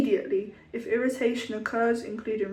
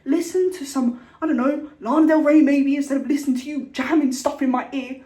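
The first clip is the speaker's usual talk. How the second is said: mock outburst; 4 semitones higher, 5 dB louder than usual. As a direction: vent at him in a hammed-up rant. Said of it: "I was like".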